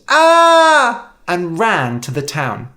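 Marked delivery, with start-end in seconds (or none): 0.1-1.1 high, loud scream of fright. A narrator's reading. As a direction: shriek and bolt. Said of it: "George screamed".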